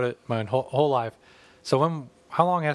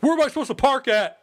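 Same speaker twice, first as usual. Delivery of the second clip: high, whiny mock question; whiny neighbor; 12 semitones higher, 5 dB louder than usual. Imitating a whiny neighbor at the town meeting, high and nasal-free whine. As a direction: mimic a fussy neighbor's pitch, pitched way up. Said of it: "Someone raised their hand, like".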